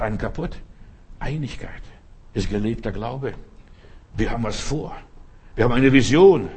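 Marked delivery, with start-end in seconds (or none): 5.5-6.5 loud declaration. Acted. Preacher declares vision.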